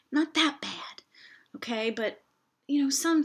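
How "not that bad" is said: whispered aside. Real, not acted.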